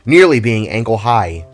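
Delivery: ordinary talk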